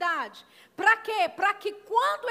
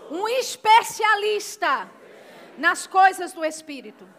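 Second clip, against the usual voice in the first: call to repeat; 8 dB louder than usual. Shown as a demonstration. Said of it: "diga assim".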